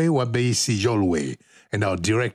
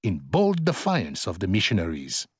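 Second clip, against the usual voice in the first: ordinary talk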